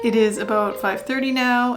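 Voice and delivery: normal talking voice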